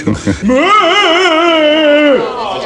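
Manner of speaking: high, eerie spooky laugh; haunt the room with a ghost's laugh; ghostly cackle. Acted. [name] still playing Marley, a spooky ghost laugh. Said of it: "What in the world was that?".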